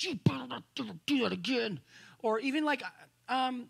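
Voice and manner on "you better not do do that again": put-on firm warning to a child; lightly voiced stern father; a hint of a stern dad scolding; stern daddy voice. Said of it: "daddy talk, like, there's authority".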